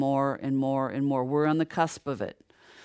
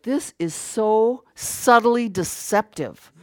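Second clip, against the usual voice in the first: slow, drawn-out; 4 semitones higher, much slower than usual. Retold or imitated. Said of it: "and I was like".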